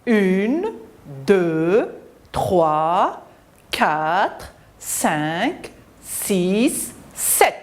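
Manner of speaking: slow count, each number separate; stretched numbers with a lift on each; counting aloud. Shown as a demonstration. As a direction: lead children counting one by one. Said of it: "Comptons les enfants".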